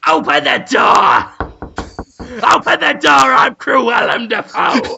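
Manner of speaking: loud, shouted villain entrance; Cruellum Dafoe. Pretend. Villain arrives at the couple's door, loud commanding shout. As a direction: demand entry with a hammed-up yell. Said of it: "Here comes the villain of the piece".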